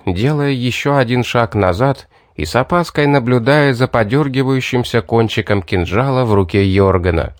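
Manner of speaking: ordinary delivery, nothing marked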